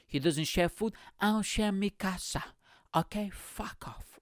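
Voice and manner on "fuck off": very soft, voiceless whisper; whispered dismissal. Acted. Telling others to leave his casa, whispered dismissal. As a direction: whisper the brush-off for comic effect. Repeats whispered refusal to share.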